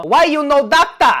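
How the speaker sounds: put-on loud, high Chinese-accented dad; mock Chinese accent. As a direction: shout it as a scolding Chinese father with hammed-up accent. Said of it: "Chinese dad says it in a different accent".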